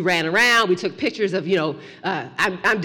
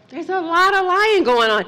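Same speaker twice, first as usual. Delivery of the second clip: preaching emphasis; 10 semitones higher, 4 dB louder than usual. Acted. Preacher's repeat.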